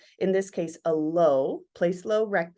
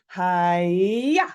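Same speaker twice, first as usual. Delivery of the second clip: hi-ya with a longer buildup and a louder hit; impact cry; 2 semitones lower, 5 dB louder than usual. Shown as a demonstration. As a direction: build it up, then land the hit. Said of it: "So there's a buildup and a hit".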